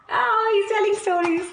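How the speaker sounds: ordinary delivery, nothing marked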